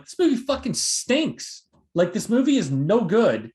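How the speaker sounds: drawn-out, high-pitched dismissive verdict; emphatic disgust. Retold or imitated. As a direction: pan it slowly, hitting each word hard. Acted past verdict on a movie.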